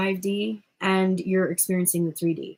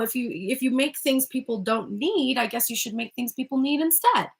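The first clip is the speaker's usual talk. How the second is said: usual speaking voice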